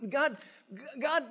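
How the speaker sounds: ordinary talk, nothing marked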